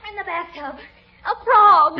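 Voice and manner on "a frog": higher, louder frightened cry. Acted. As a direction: squeal it out in fright. Frog in the bathtub.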